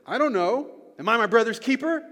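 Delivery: loud, high-pitched defiant retort; Cain. Acted. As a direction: throw it back as an indignant question. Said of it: "that's how he said it".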